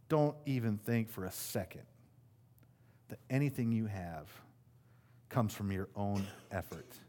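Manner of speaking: a hint of a quiet stern God; God speaking. Pastor voicing God warning against self-reliance, quiet and stern. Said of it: "And God says".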